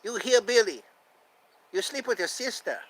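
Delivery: scornful put-on cartoon voice; Laotian neighbor impression. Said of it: "I like how he calls him hillbilly".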